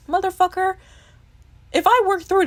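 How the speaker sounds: high, loud exasperated burst; exasperated outburst. Real, not acted. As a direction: blow up at the imagined therapist.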